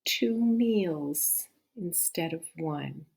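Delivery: slow, pondering repeat; slow echo. Shown as a demonstration. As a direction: mull over each word slowly. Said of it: "I wonder what the wolf means".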